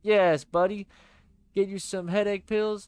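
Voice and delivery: loud, bright pal voice; cheery buddy voice. Acted. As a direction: play the upbeat best friend.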